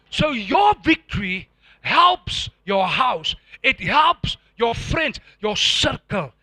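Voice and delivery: slow, loud shouted preaching; preaching at full force. Acted. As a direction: drive the point home to the congregation.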